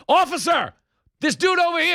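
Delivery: very high-pitched yell for police; hammed-up cry for an officer; mock calling out. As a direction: holler like an aggrieved scammer. Acted hustler calling for police.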